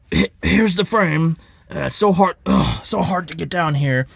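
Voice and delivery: high, stammering, whiny put-on voice; mocking a flustered seller. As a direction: hem and haw like bending down is torture. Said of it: "He's like".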